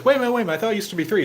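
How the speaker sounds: high, startled objection; a lightly voiced startled guy; surprised protest.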